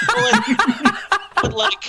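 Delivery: high, helpless laughter through the words; words breaking up in laughter; laughing. Real, not acted. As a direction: try to speak while cracking up. Laughing too hard to speak.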